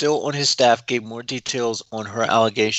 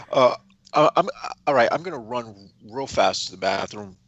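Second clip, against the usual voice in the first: ordinary talk, nothing marked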